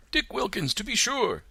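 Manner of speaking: high, eager recognition; light up at an old friend; delighted Scrooge. A narrator's reading. Scrooge telling the ghost, high and delighted.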